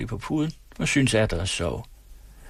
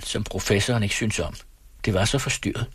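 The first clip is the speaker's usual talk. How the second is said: plain reading voice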